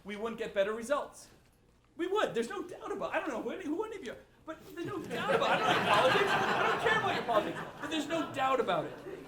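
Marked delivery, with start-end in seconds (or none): 2.0-9.3 higher and louder, laughing through the words; rally the room with a grin; laughing excitement. Real, not acted. Joking to audience, crowd laughs.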